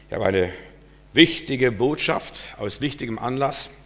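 ordinary talk, nothing marked

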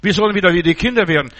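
ordinary talk, nothing marked

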